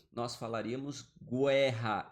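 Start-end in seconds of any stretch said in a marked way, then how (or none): 1.2-2.1 "guerra" said as "güerra", with a w glide; sounded U. Shown as a demonstration. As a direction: stress "guerra" with the U pronounced, "gwerra". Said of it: "se ele fosse pronunciado". ("guerra" com o U pronunciado, "güerra")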